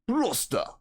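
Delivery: high-pitched exclamation in character; acted outburst. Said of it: "He's like".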